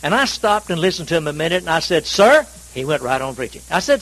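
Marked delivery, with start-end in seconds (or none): 2.0-2.5 raised, high call for attention. Retold or imitated. Man tries to interrupt a preacher, calling out. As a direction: call out to cut in, retold.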